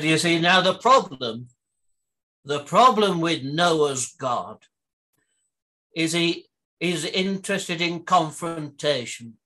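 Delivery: a gentle school-play take on the Prime Minister; Harold Wilson impression. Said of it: "it turned out to be Harold Wilson".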